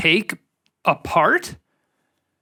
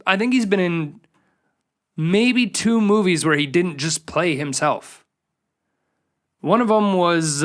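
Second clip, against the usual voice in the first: ordinary talk, nothing marked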